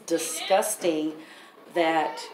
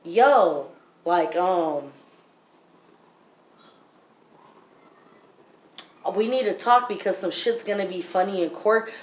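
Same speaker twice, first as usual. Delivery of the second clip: louder, urgent warning to a lawyer; retold urgent call; 3 dB louder than usual. Mother retelling her call to the attorney, louder and urgent. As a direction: press your lawyer to listen. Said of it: "and I told him".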